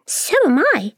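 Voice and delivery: high, eager ladybird; high little ladybird cry. Acted. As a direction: squeak it out, keen to agree. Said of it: "the ladybird cried".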